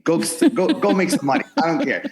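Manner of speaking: high, loud, insistent urging; pushy yell. Retold or imitated. Girlfriend pushing him off the couch, loud insistent urging. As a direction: girlfriend shoving him to go earn. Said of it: "she's like".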